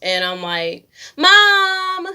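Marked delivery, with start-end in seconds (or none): 1.2-2.1 loud high scream; screaming for mom. Retold or imitated. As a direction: yell for mom in panic. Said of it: "I'm screaming".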